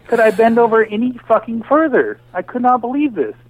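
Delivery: a man ranting about a bank bailout, incredulous; exasperated disbelief. Retold or imitated. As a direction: retell your own indignant outburst. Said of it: "And I'm like".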